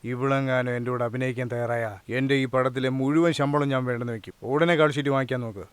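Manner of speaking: soft, low confiding voice; smitten aside. Acted. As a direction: murmur it low, quite taken with her. Smitten hero confides about the heroine. (പതിഞ്ഞ, മോഹിതനായ സ്വരം)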